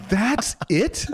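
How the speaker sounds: high, incredulous question; mock disbelief. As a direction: act out a baffled letdown, pitch jumping up. Let down by her anticlimactic advice.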